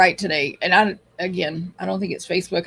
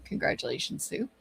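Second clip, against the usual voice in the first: whispered congratulations; 5 semitones lower, 10 dB quieter than usual. Real, not acted. Aside to a viewer.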